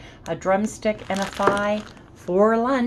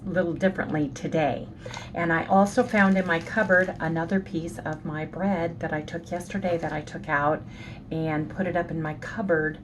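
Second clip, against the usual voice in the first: ordinary delivery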